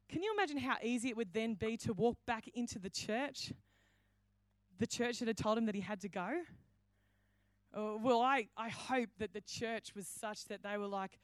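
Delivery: ordinary delivery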